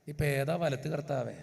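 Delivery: low, weary plea to the Lord; exasperated aside. Real, not acted. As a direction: sigh at the confusion. Exasperated at crowd confusion.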